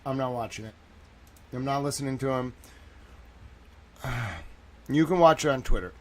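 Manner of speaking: ordinary talk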